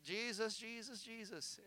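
soft, absent-minded praying; trailing, rote murmur; mindless repetition. Acted. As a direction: imitate someone repeating a prayer on autopilot. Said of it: "I've been saying that for fifteen minutes".

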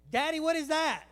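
put-on eager child pestering Daddy; inquisitive kid. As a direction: pipe up like a curious child. Voicing an inquisitive kid to parent.

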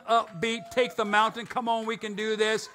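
usual speaking voice

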